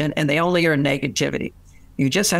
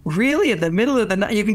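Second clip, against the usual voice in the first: high, amazed retold reaction; retold wide-eyed "really?", jumping high; impressed surprise; 4 semitones higher, faster than usual. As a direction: imitate a woman's impressed surprise, pitched well up. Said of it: "see the tone of her voice".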